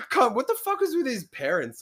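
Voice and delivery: high-pitched disbelief; squeaky, exasperated question; incredulous outburst. Real, not acted. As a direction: blurt it out, baffled and amused. Incredulous outburst about friend's parents.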